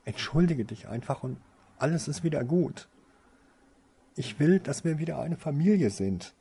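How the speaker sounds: begging her through tears to make it right; tearful pleading. A narrator's reading. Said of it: "darin Tränen zu entdecken".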